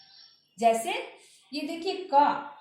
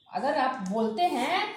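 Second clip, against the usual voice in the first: ordinary talk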